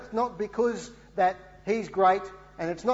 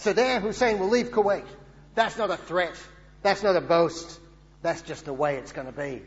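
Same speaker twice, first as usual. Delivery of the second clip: second go at George Bush; 6 dB louder than usual. Retold or imitated. Preacher retrying his Bush impression, a louder hammed-up American accent. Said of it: "But I'll give it a".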